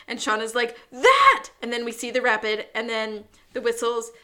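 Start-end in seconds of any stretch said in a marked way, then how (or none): 0.9-1.5 sharp high yelp, much louder and higher; a panicked one-word shout at the danger; alarmed yelp. Retold or imitated. Scared friend pointing at rapids.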